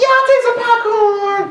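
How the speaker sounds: squeaky high girly voice, hammed up; girl impression. Preacher doing his impression of girls, squeaky and high. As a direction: ham up a girly yes to popcorn. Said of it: "That's my impression of girls".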